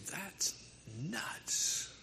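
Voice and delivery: breathy whisper of disbelief; whispered aside. Retold or imitated. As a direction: whisper it like a private verdict. Mock reaction in a sermon.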